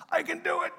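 high, cocky mock voice; mock bravado. Mocking the congregation's bravado, high and cocky. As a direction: play the overconfident rower.

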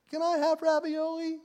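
put-on high little-kid question; innocent kid. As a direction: ask sweetly in a high child voice. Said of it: "Kid comes up and asks you an innocent question".

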